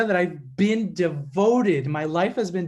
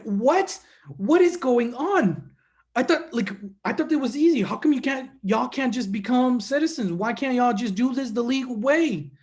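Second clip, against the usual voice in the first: higher, baffled protest in a friend's voice; imitated frustration; 5 semitones higher, 3 dB louder than usual. Friend learning there is no easy path to citizenship, frustrated. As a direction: act out a buddy's exasperated disbelief. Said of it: "He was frustrated".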